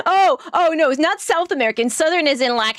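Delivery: usual speaking voice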